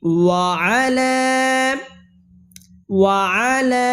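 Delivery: ওয়া আলা recited slowly with final vowel held; short "ওয়া", long drawn "লা" in ওয়া আলা; long stretch. Shown as a demonstration. Teacher demonstrating for learners, ওয়া আলা with long held ending. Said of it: "তিন আলিফ টান দিতে হবে".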